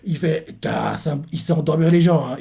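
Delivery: usual speaking voice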